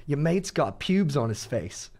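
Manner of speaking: slow, drawn-out imitation British accent; mock a British woman's taunt, stretched out. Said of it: "five British girls walk in".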